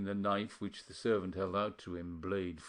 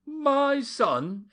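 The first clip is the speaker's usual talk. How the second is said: loud, high, outraged cry; angry exclamation; 8 dB louder than usual. A narrator's reading. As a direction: spit it back in outrage. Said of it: "exclaimed the other angrily".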